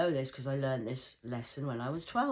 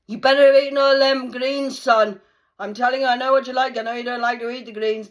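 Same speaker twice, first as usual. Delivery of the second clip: loud, higher mock-dad telling off; scolding dad; 10 semitones higher, 18 dB louder than usual. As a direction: bellow at the kid about his greens. Dad scolding son over greens.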